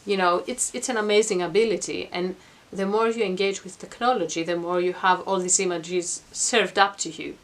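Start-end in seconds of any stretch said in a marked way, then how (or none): none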